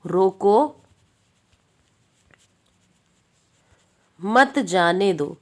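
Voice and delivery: रोको, a very long pause, then slow मत जाने दो; pause after रोको. Shown as a demonstration. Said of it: "तो पहला है". (रोको पर रुककर, धीरे से मत जाने दो)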